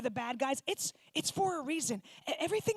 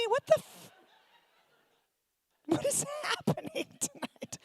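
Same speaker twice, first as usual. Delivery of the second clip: much higher, squeaky disbelief; comic alarmed squeal; mock shock; 13 semitones higher than usual. Real, not acted. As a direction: shriek at the silence. Roasting a silent audience.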